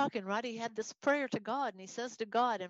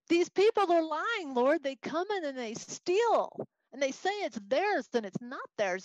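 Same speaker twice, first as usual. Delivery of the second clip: high, raised, protesting voice; indignant complaint; 5 semitones higher, 4 dB louder than usual. Acted. Teacher as the prophet telling the Lord of theft, indignant. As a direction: plead the case against the thieves.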